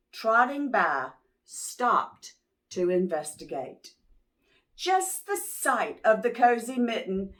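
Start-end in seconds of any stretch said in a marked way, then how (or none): none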